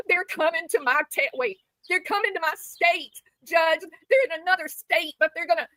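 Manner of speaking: high, whiny mock terror, pleading; mocking Brittany to the judge. Mocking Brittany pleading with the judge, in whiny mock terror. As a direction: play the frightened victim, hammed up. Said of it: "Shut up, Brittany".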